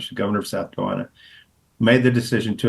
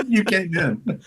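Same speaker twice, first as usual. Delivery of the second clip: laughing together; 9 semitones higher, slower than usual. Real, not acted. Both laughing.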